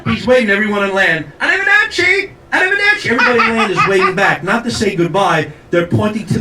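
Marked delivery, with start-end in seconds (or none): 1.4-3.1 high-pitched Italian captain calling out; hammed-up Italian farewell; Italian accent. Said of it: "That's an Italian accent".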